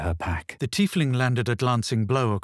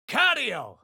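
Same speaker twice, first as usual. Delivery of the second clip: high shouted finish; spell cry; 14 semitones higher than usual. Acted. Adventurer finishing a spell, high cry. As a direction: snap the last word out high.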